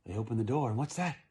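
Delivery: usual speaking voice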